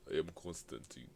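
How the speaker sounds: softly imitated Russian introduction; Russian accent. Friend re-enacting the job rival's voice, Russian accent. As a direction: mimic the Russian man giving his name. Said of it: "that voice, the voice didn't match the body".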